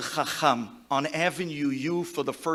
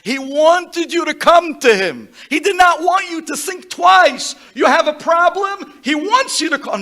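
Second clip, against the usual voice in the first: loud, high preaching cry; impassioned eulogy; 10 semitones higher, 11 dB louder than usual. Real, not acted. Eulogy crowd, rabbi addressed passionately, loud high preaching. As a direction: proclaim it to the mourners at full force.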